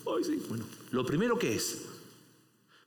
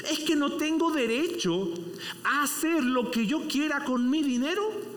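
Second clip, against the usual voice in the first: fervent preaching; 8 semitones higher than usual. Acted. Preacher retelling the vineyard parable, preaching at full force. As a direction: drive the parable home with fire.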